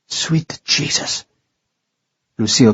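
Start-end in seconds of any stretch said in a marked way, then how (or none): 0.1-1.3 slow shocked whisper; whispered oath. Acted. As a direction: breathe it out in horror. Woman shocked at the bloodshed.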